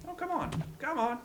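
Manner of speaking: high, urgent coaxing; goading. Pretend. Player lures cannibals onto traps, goading. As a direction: egg them on, pitched well up.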